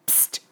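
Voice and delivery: unvoiced hushed psst; whispered psst. A narrator's reading. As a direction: hiss a quiet psst to wake him. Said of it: "said Little Pip".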